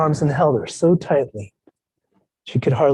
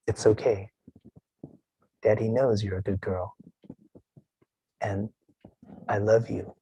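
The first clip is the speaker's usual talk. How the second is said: put-on much softer, tender whisper to a child; 4 semitones lower, 9 dB quieter than usual. Father whispers comfort into his daughter's ear, soft. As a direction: hush her gently in her ear. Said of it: "I whispered in her ear".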